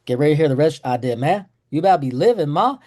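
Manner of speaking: put-on bratty eight-year-old mouthing off, fast; defiant kid talkback. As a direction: fire it back at mom with a smirk. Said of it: "I'd say".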